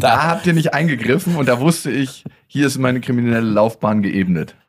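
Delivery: loud, hammed-up blame; mock accusation. Parent playing the jailed daughter blaming them, loud mock accusation. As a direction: accuse the parents with playful melodrama.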